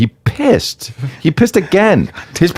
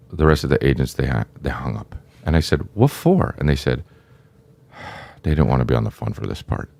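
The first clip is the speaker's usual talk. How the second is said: low, soft bad-news delivery; grave agent; 8 semitones lower, 6 dB quieter than usual. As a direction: break it gently and low.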